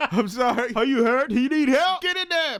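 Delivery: hammed-up rescue bark; mock urgent agent. Host acting out the agents breaking in, urgent and high.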